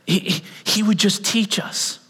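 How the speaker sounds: ordinary talk, nothing marked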